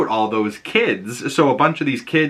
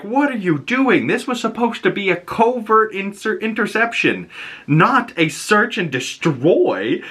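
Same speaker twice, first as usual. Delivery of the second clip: much higher, exasperated boss voice; scolding government boss; 12 semitones higher than usual. Retold or imitated. Doctor Cooper scolds Polaris in a much higher, exasperated voice. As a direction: squeak it out like an outraged official. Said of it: "and she's like".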